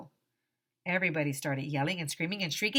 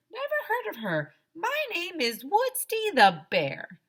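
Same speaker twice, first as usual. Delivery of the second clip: bear voice; 12 semitones higher than usual. Acted. Woodsy introduces himself to the class, high cartoon voice. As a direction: deny it with a silly high bear voice.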